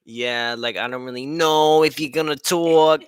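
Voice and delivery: imitating a roommate's New York accent; mock New York accent. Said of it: "they got a New York accent".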